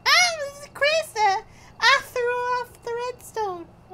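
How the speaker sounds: squeaky high chipmunk voice; high-pitched voice. Real, not acted. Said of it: "Doesn't sound like Krista".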